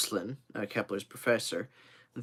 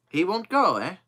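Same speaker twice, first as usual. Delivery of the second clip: higher, louder, sceptical echo; challenging echo; 7 semitones higher, 6 dB louder than usual. Acted. Joe answering Kennedy's doubt about the trip, challenging echo. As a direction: throw the words back as a challenge.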